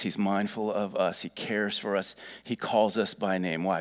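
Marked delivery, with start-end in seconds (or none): none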